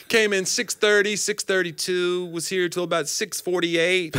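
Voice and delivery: high, slow, deliberate recall; New York bartender. Acted. Bartender recalling a customer for police, mock-deliberate. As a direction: reel off details in a high character voice. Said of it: "the New York bartender who remembers".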